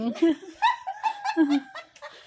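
high delighted laugh. Real, not acted. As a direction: burst out laughing. Parents laughing at the baby.

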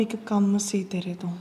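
soft secretive whisper. Acted. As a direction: whisper the favour you need. Secret request to sister-in-law.

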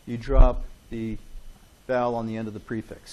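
usual speaking voice

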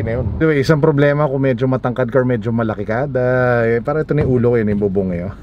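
muffled by cloth mask; speaking behind mask; tags: through a face mask. Real, not acted.

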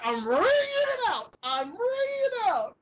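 high, strained mock shrieking; sketch character Georgina. Imitating a sketch woman attacking Satan, frenzied. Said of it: "she's like".

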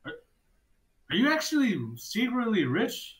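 a stunned GM asking a player; incredulous. Real, not acted. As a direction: disbelief at the player's wealth. GM reacts to berry generosity.

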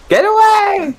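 very high mock shout of Get away; Bloodborne hunter shout. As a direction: yell it like a game hunter. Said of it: "come quelli di Bloodborne". (grido acutissimo da cacciatore)

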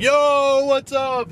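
put-on high, loud "Yo, what's up" to old friends; surprised greeting. Spotting friends at the polling place, a high loud greeting. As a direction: call out in sudden surprise.

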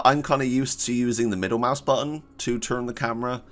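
ordinary delivery

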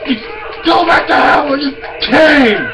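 high frantic yelling; panicked shouting. Real, not acted. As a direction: scream at the monster. Fighting enemy in panic.

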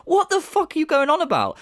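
high-pitched disbelief; exasperated retort. Real, not acted. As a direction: shoot it back high and incredulous. Exasperated retort at Phil's self-contradiction.

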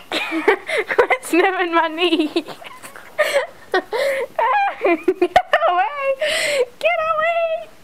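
laughing high squeals; high laughing get away. Real, not acted. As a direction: giggle while pushing the dog away. Laughing at dog sniffing.

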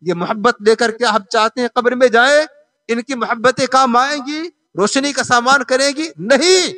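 full-force preaching. Real, not acted. Preacher challenges congregation about worldly loves, rousing sermon. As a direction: drive each item home like a preacher.

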